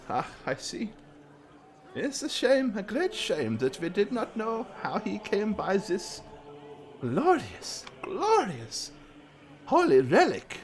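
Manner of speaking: high, soft, fawning cleric voice; high priest voice. Acted.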